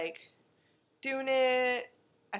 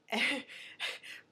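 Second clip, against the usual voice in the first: breathy laugh; 3 semitones higher, 5 dB quieter than usual. Real, not acted. Laughs at silly line.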